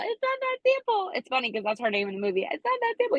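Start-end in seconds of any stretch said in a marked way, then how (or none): 0.0-1.1 much higher, breathy heroine voice; mock Allie from The Notebook, way up high. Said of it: "Allie's all". 2.5-3.2 much higher, breathy heroine voice; mock Allie from The Notebook, way up high. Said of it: "Allie's all".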